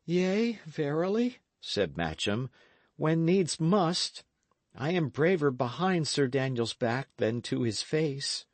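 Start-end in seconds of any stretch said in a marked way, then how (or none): 0.0-1.4 a timid youth making a pledge; Matcham. A narrator's reading. Matcham speaks. 3.0-8.5 a timid youth making a pledge; Matcham. A narrator's reading. Matcham speaks.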